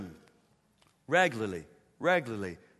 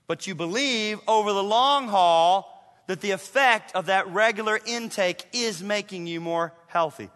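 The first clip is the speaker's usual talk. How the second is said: usual speaking voice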